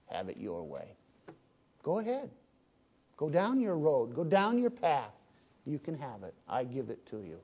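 quiet, resigned judgment. Acted. Preacher voicing God's quiet judgment.

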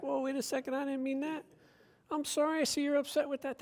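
mock hushed backpedal; backpedalling apology. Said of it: "I'm gonna be like".

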